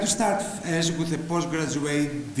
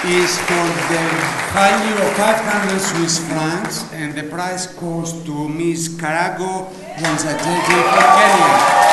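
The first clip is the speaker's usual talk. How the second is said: loud, slow ceremonial announcement, rising on the winner's name; prize announcer; 2 semitones higher, 9 dB louder than usual. Real, not acted. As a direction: call the winner's name out to the whole hall.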